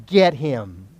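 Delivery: ordinary delivery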